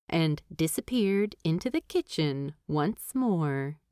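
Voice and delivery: usual speaking voice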